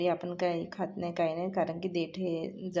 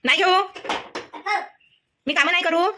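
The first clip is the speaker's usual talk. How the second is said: loud calling; 9 semitones higher, 11 dB louder, faster than usual. Real, not acted. Calling toddler away from mischief. (खोडकर बाळाला मोठ्याने हाक मारणे)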